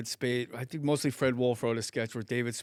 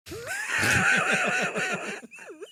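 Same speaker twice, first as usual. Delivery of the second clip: huge laugh. Real, not acted. Big laugh at punchline.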